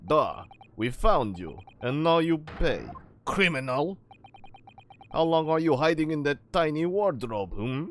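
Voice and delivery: loud Russian sailor voice; Russian accent. Acted. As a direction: scold him in a forceful Russian accent. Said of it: "it's the Russian, other Russian sailor".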